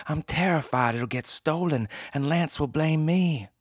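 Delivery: anxious woman. Acted. Frightened client voiced high.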